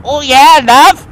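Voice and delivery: high, loud Geordie "oh yeah, love"; mock Geordie accent. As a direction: ham up a Geordie greeting. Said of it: "like, Geordie accent".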